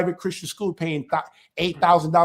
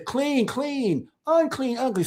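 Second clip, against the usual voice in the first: imitated teaching voice; 6 semitones higher, slower than usual. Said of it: "she says".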